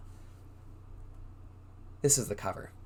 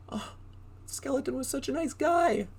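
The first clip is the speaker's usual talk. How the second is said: sad; 14 semitones higher than usual. Acted. Sad about stolen friend.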